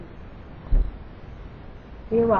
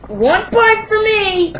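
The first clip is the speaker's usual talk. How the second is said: loud, slow, high triumphant cry; excited shout; 11 semitones higher, 9 dB louder, much slower than usual. Real, not acted. Girl claiming a point in the burping contest, shouting triumphantly. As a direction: crow it out like a winner.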